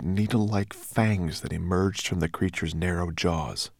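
usual speaking voice

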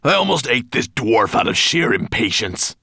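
loud, high angry dragon voice; loud, irritated growl; impatient growl. A narrator's reading. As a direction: growl at a servant who kept you waiting. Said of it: "Nettlebrand growled at Twigleg".

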